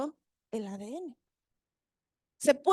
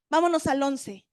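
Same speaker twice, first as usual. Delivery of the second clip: normal talking voice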